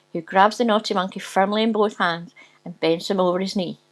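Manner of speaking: usual speaking voice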